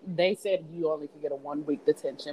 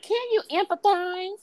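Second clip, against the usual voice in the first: much higher, slow preachy voice; mock gym teacher; 12 semitones higher than usual. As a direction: lecture them in a prissy high voice. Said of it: "She's like".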